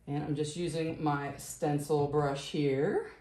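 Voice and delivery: normal talking voice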